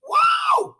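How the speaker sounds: squeaky high loud wow; wow, shooting way up in pitch; mock astonishment. Real, not acted. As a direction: gasp it out in disbelief. Exclamation about three-day drunk.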